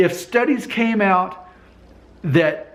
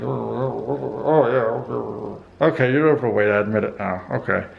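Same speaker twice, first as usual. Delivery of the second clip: a viewer caving in, voiced lower and trailing off; grudging viewer; 7 semitones lower than usual. Imitating a viewer who finally admits he's overweight, in a low grudging voice. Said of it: "you're like".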